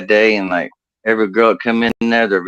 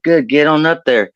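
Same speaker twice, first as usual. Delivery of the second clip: raised-pitch country foreman giving orders; 5 semitones higher than usual. Acted. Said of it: "my boss was redneck as hell".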